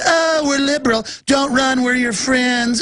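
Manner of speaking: lightly voiced fake friendly liberal; fake effeminate voice. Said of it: "voices of these fake effeminate guys".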